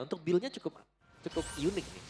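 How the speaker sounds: normal talking voice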